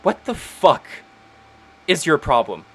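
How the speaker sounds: sharp, upset demand as Penelope; tearful outburst. Acted. Penelope snapping at Iker through tears. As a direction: confront him through tears. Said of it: "and she just goes".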